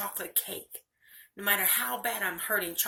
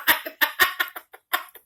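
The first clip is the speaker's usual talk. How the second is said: high, loud burst of laughter; 7 semitones higher, 11 dB louder than usual. Real, not acted. Speaker laughs at her own bitterness, loud laugh.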